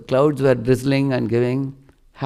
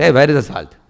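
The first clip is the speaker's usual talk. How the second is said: mock scolding; 4 semitones higher, 6 dB louder than usual. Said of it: "people will scold".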